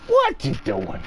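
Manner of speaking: put-on very high, squeaky baby voice to a cat; pet talk. Showing how they talk to their cat, in squeaky pet talk. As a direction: coo at the cat.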